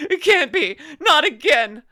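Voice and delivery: loud, high cry of mock despair. Streamer after failing the math again, wailing in mock despair. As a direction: wail it like a hammy tragedy.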